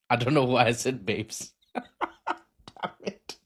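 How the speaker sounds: normal talking voice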